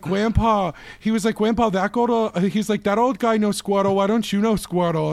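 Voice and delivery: put-on a kid amazed a grown-up doesn't know Squirtle; excited kid.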